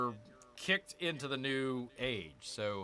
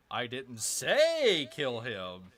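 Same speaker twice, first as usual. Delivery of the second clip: "say" loud and raised in "I didn't say kill him", slow; stress on say; 6 dB louder, much slower than usual. Shown as a demonstration. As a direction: lean on "say". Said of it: "wherever you're emphasizing".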